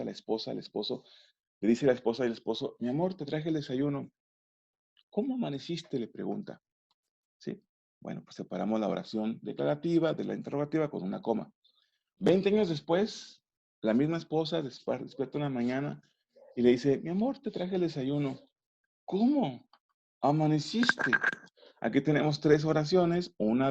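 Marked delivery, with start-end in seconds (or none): 2.8-6.0 soft, sweet newlywed wife asking gently; tender question. Acted. Newlywed wife to husband at breakfast, a soft tender question. 17.0-21.1 ¡Cómo amaneciste! jumps high in mock dismay; shocked exclamation. Wife twenty years later sees her husband, a mock shocked exclamation. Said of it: "una interrogativa y una exclamativa".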